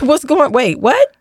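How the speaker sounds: high startled double take, jumping up at the end; squeaky-high, incredulous retelling; retold disbelief. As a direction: play up the baffled shock. Retelling her surprise at the script.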